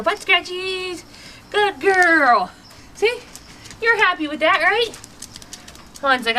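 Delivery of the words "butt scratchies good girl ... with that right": higher, slow cooing praise. Real, not acted. Trainer cooing at her dog over scratches. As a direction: coo over the happy dog.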